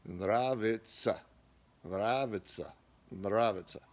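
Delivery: нравится ending in a short uh, not ya; final ya as uh. Shown as a demonstration. Teacher repeating the word, final vowel reduced. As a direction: say NRA-vi-tsuh. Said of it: "that's typically just said uh".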